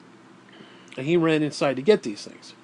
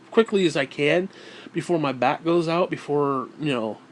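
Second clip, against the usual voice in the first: ordinary talk, nothing marked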